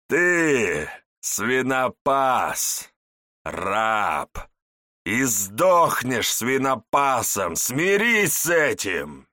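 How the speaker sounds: slow, loud, contemptuous tyrant; villain voice. Acted. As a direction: sneer each word down at a slave. Village elder berates Ichiro.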